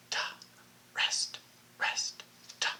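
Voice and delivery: faint whispered rhythm under the students' turn; mouthed along. Shown as a demonstration. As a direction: barely breathe the syllables so they lead. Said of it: "You try it by yourself".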